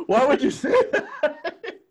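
high, loud wail through laughter; laughing mock protest. Daughter protesting family's church prank, laughing mock outrage. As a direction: protest slowly while cracking up.

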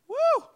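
high, loud whooping cheer; excited woo. Real, not acted. Leader cheers at the group, loud excited whoop. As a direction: let out a real whoop. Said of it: "I'm gonna scream the whole night".

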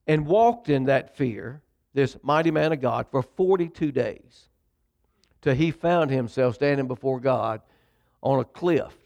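ordinary talk, nothing marked

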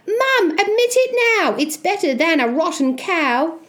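put-on high, eager young Jack; crying out. Boy urging his mum to admit it, high excited cry. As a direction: call out to Mum, high and quick at first. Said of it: "young Jack cried".

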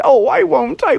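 high-pitched apologetic shark; cowed shark. Acted. Shark apologising to father bear, high cowed voice.

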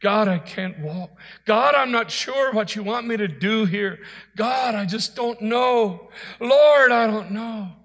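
pleading prayer building from conversational to a loud cry; crying out to God. Acted. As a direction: plead helplessly, rising in pitch and volume. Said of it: "Have you cried out to him".

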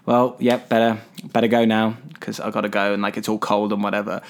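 low, softly mumbled farewell; imitating an awkward goodbye. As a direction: make a half-hearted, awkward exit. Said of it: "it's a bit awkward".

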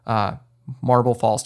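normal talking voice

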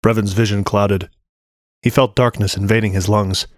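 ordinary delivery, nothing marked

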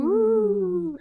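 high, loud spooky woo; ghostly woo. Pretend. Co-host joins in on the ghost noise, ghostly. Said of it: "like ghosty".

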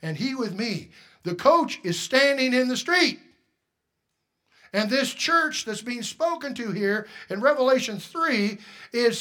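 loud, high, driving sermon delivery; fiery pastor preaching; preaching at full force. Acted. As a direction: preach it hard to the room.

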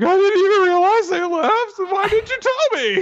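very high, hammed-up squeal; mock panicked wail. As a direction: wail it like a distraught boy. Acting Taiki's panicked reaction.

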